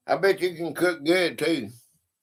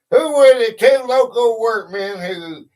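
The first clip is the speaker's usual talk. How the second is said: higher, louder quizmaster voice; quiz question read-out; 6 semitones higher, 6 dB louder than usual. Real, not acted. Host puts a history question to players, loud quiz read-out. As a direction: put the question to all the players.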